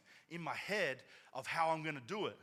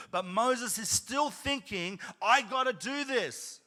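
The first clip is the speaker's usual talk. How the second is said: high, loud, building preacher cry; fired-up pastor preaching; preaching at full force; 11 semitones higher, 12 dB louder than usual. Real, not acted. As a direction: drive it home to the congregation. Preaching climax, rising.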